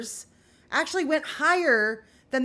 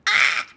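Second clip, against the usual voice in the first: loud exasperated Ah; loud, frustrated outburst; frustrated groan; 9 dB louder than usual. Retold or imitated. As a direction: let out a fed-up yell. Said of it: "put a few swear words in there".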